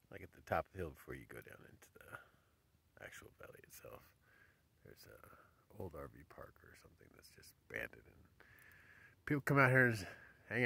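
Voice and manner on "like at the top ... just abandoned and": soft unvoiced whisper. Real, not acted. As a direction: hush it as if the desert might hear. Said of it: "I don't know why I'm whispering".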